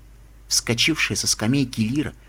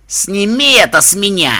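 loud, high angry growl; muffled growl; 6 semitones higher, 6 dB louder than usual. Acted. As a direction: growl it out from under the bin. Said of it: "глухо прорычал Рок".

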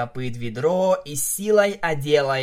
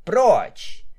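high, loud order to back off; sharp command; 8 semitones higher, 6 dB louder than usual. A narrator's reading. Narrator mare shoves Vinyl away, sharp command. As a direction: order them away, loudly.

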